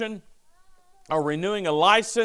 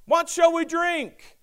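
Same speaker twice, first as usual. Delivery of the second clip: mock whine; 10 semitones higher, 3 dB louder, slower than usual. Quoting the grumbling Israelites.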